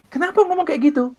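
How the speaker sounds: father's startled high question on the phone; surprised father. Retold or imitated. Father startled on the phone by his son's love, high and surprised. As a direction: jump up high, caught off guard by the call. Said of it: "Dia kaget".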